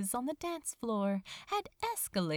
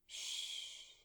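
soft whispered hush; 13 dB quieter than usual. Acted. Crow hushing sleepy Azra, soft whisper. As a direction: hush him back to sleep.

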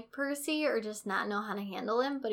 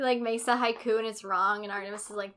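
ordinary talk

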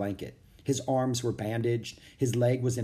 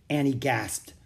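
plain reading voice

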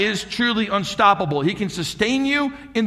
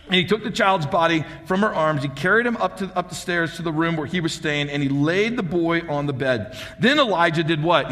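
ordinary talk, nothing marked

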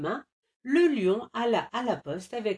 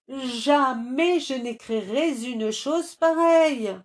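furieux; 6 semitones higher, 6 dB louder than usual. A narrator's reading. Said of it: "Le lion, furieux".

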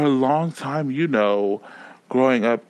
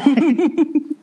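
high laugh; 4 dB louder than usual. Real, not acted. Laughing at a remark.

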